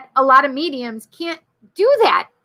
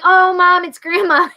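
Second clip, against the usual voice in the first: raised, higher call to mom; lightly acted youthful call, louder and higher; child calling out; 6 semitones higher, 4 dB louder than usual. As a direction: imitate a kid announcing a caller. Child self calling out to mother.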